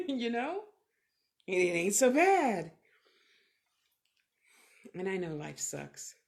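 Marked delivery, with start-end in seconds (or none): 0.0-0.6 a laugh breaking into the words. Real, not acted. Laugh heard on line. 1.5-2.7 much louder, "bad" hit hard; loud emphatic. Real, not acted. Repeats phrase, second time louder.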